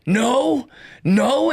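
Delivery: hammed-up panicked denial; freaking out. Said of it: "he's, like, freaking out".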